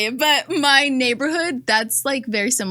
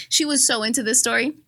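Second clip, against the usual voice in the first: ordinary talk, nothing marked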